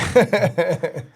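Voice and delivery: loud chuckle at own remark; short burst of real laughter. Real, not acted. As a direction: laugh heartily after your own quip.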